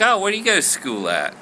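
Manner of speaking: high, quick Southern voice; high-pitched Southern curiosity; chatty Southern lady. Acted. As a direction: ask brightly in a high Southern accent. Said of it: "She's from the South".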